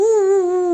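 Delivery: high, loud imitated monkey hoot. Telling viewers how the baby monkey started, loud imitated hoot. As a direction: hoot like the little monkey on the wall. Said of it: "ऊ करनी चालू कर दी".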